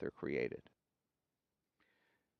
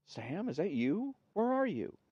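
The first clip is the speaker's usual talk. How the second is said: amazed; 9 semitones higher than usual. A narrator's reading. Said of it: "Mo calling to a ghost".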